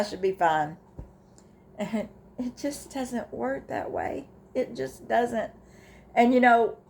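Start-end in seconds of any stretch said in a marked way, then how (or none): 1.8-5.5 softer and higher, slow, voice catching; near tears. Real, not acted. Widow telling viewers grief doesn't work that way, softly choked up. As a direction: hold back tears as you insist.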